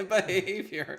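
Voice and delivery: laughing mid-sentence. Real, not acted. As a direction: breaks into a laugh while telling it. Laughing at the riot.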